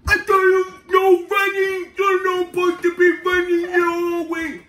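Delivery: high, sustained mock scolding shout; imitated patrol kid. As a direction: drag out the rule like an overexcited hall monitor. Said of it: "So he come over".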